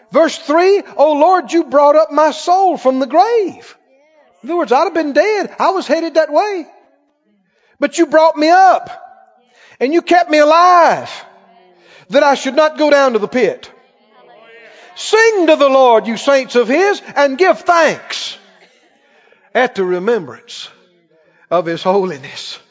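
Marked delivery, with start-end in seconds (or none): 1.0-3.8 high, loud, drawn-out proclamation; preacher crying out a verse; impassioned scripture reading. Acted. Reads psalm at full force. 7.8-22.6 high, loud preacher's proclamation; full-force psalm reading; impassioned scripture reading. Acted.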